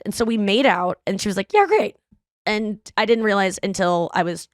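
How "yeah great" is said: high, chirpy mock approval; imitated friend's perky okay; breezy approval. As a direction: toss off a quick high-pitched verdict. Friend's brisk verdict after kiss.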